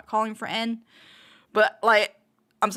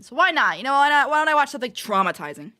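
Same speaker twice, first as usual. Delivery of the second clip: sarcastic; 5 semitones higher, 7 dB louder than usual. Real, not acted. Sarcastic resolve to watch trauma.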